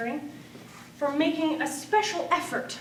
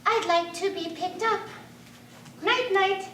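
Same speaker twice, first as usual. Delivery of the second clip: talking doll; 6 semitones higher than usual. Acted. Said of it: "She says".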